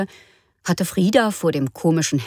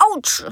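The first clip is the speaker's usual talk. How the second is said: high ouch; 11 semitones higher than usual. Acted. Mother burns again.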